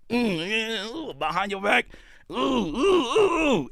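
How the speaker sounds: high, drawn-out mock oohs; imitated streetball oohs. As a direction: mimic flashy moves with high oohs. Mimics showy streetball dribbling moves.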